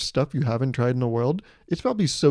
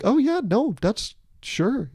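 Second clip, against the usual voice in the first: high-pitched, over-accommodating agreement; agreeable passenger; 9 semitones higher, slower than usual. Retold or imitated. Passenger told of doom by crew, overly agreeable. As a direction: reassure them in a high, eager mock voice.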